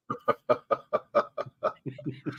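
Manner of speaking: long, soft unvoiced chuckle; laugh. Real, not acted. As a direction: laugh quietly at your own joke. Laughs at own joke.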